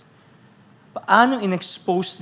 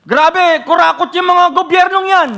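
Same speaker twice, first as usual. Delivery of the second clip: much higher, much louder mock rant; mock outraged worker; 13 semitones higher, 11 dB louder than usual. Worker who cheats on tax ranting, mock shouted outrage. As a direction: rant about the government like a hypocrite. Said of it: "Sino po dito nagwo-work na".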